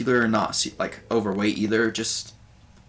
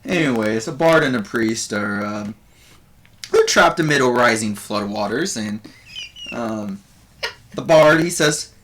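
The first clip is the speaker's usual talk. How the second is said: raised, louder comic bard voice; bard doing stand-up; 5 semitones higher, 4 dB louder than usual. Acted. Game master voices the bard Buvor greeting the audience, higher and louder. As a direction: Buvor working the crowd as a nervous comedian. Bard telling a flood joke.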